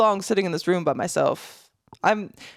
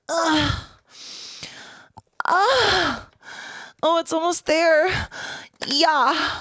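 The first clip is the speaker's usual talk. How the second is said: straining grunts; 7 semitones higher, much slower than usual. Retold or imitated. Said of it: "sounds like".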